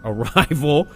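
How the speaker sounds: normal talking voice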